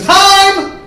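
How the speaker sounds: put-on high, loud kid's shout; yelled time out. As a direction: call the game to a stop. Kid yells time out in yard.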